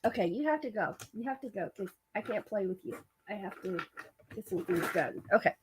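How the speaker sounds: hushed aside to an animal; speaking to her cat, quiet and gentle; soft talk to pet. Real, not acted. Talking softly to her pet.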